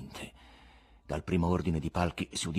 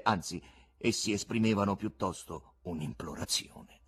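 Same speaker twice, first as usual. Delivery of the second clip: ordinary delivery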